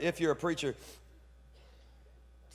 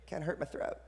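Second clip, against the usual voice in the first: mock sore-throat aside; 6 dB quieter than usual. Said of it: "sometimes I'm like".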